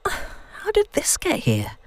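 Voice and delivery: soft, slow puzzled question, pitched high; quietly puzzled, half to herself; hushed bewildered wondering; wondering aloud. A narrator's reading. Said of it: "she wonders aloud".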